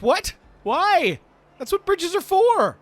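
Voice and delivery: very high, loud outcry; indignant protest. Acted. Lortho protests the guard's fishing ban, indignant high shout. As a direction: squawk it in loud disbelief.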